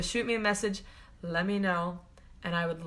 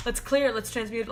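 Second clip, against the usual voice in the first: ordinary talk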